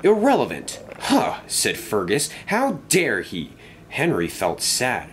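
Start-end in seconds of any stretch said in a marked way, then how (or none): 0.0-1.4 high, affronted echo; scoffing, indignant disbelief. A narrator's reading. Fergus offended at being called irrelevant. 2.5-3.5 indignant. A narrator's reading. Fergus offended at the insult.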